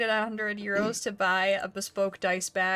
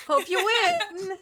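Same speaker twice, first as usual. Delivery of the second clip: high, slow, laughing repeat; 11 semitones higher, 6 dB louder, much slower than usual. Real, not acted. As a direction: laugh through the words.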